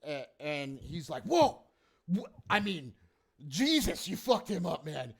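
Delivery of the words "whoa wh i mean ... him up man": higher-pitched, dismayed hobgoblin reproach; stunned protest. Acted. Hobgoblin to players who killed his man, appalled protest.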